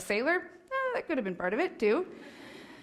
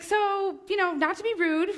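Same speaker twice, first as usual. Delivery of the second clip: higher, carefully polite imitation; super polite husband; 8 semitones higher than usual. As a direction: tiptoe into the question politely. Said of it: "'cause he's super polite".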